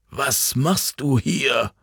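put-on slow, urgent old man's voice; a worried old man demanding an answer; old man, concerned. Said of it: "fuhr er Jan an".

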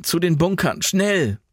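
high, pressing command; urgent call. A narrator's reading. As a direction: urge them to run for cover. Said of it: "rief Jan".

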